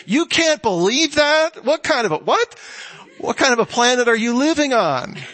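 can't believe what he's hearing; incredulous. Acted. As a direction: pitch leaping up in disbelief. Incredulous reply to the self-righteous.

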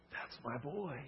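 very soft, tender delivery; hushed declaration. Acted. God claiming a child, spoken very softly. God's shout softly voiced over congregation.

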